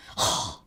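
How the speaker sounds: sharp, scandalized gasp; mock gasp. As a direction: imitate a shocked gasp. Imitating his shocked reaction to makeup.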